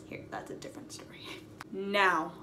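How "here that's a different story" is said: fast whispered aside. Real, not acted. A quick whispered aside to the viewers. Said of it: "whispered aside".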